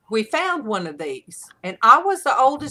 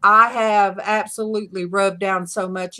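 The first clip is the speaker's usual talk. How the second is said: ordinary delivery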